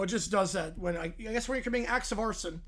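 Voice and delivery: ordinary delivery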